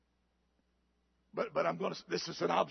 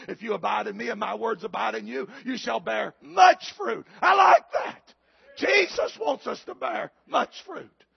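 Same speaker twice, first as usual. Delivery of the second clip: preaching at full force; 3 semitones higher, 9 dB louder than usual. Acted. Scripture climaxing into a shout.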